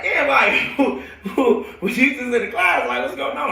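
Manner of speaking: high-pitched, laughing retold question; laughing mock confusion. Student asked about being in the class, laughing confusion. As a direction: act out bafflement through laughter.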